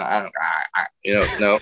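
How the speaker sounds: ordinary talk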